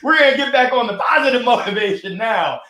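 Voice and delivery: laughing, a bit choked up; teary laugh pulling it together; laughing through tears. Real, not acted. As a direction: laugh it off while tearing up. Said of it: "You're making me tear up".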